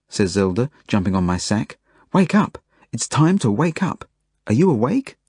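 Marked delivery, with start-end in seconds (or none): 2.1-5.2 high-pitched, insistent wake-up; eager child rousing a friend; urgent waking call. Acted. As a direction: pester a sleeper awake in a high voice. Zelda jumps on sleeper to wake him.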